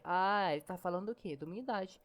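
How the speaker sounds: normal talking voice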